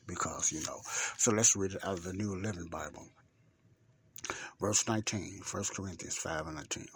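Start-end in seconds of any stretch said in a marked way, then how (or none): none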